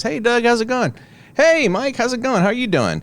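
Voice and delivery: cheerful hello; friendly greeting. Retold or imitated.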